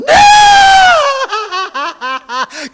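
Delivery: very high, loud shrieking witch cackle. Acted. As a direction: shriek with glee. Creature laughs shrieking.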